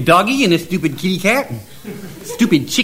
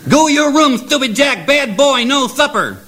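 angry scolding; 9 semitones higher than usual. Acted. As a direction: scold him hard, send him to his room. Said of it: "her was real angrified".

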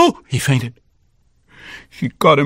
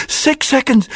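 plain reading voice

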